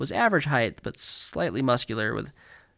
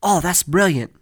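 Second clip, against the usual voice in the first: loud, higher-pitched mock-British; hammed-up British film exclamation; British accent; 5 semitones higher, 9 dB louder than usual. Quoting a Harry Potter phrase, exaggerated British accent. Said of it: "say a lot in Harry Potter".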